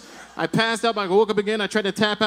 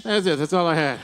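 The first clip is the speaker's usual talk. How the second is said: normal talking voice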